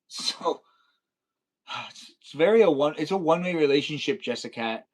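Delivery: ordinary delivery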